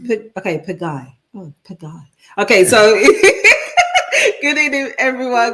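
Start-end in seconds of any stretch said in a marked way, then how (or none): 3.0-4.4 high, loud giggling burst; burst of laughter. Real, not acted. Co-host laughing after fumbling a viewer's name, high giggle. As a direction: break into a high, bright peal of giggles.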